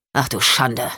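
put-on a stunned old lawman; sheriff, dismayed. Sheriff reacts in shock.

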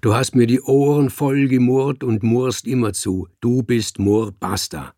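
lauter, bestimmter Dichter. Acted. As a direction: mit Nachdruck entscheiden. Dichter streitet mit dem Kater. (der Dichter setzt den Namen durch, laut und bestimmt)

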